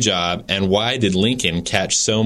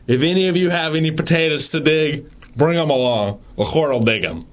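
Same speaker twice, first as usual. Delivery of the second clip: Delahaye voice; 5 semitones higher than usual. Retold or imitated. Host reads a judge's courtroom quotes in a higher, pompous voice. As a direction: read the old judge's quotes as a lofty, self-important judge. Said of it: "in my best Delahaye voice".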